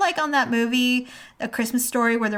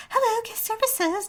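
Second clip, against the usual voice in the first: phone operator greeting; 12 semitones higher than usual. Retold or imitated. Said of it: "So I get to guest services".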